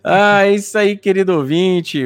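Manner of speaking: excited host. Real, not acted. Host hyping the guest's return.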